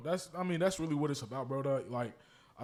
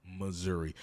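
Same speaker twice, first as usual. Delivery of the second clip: lower, softer, slow insistence; deadpan correction; 9 semitones lower, 11 dB quieter, slower than usual. Real, not acted. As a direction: correct them calmly and slowly. Patient correction.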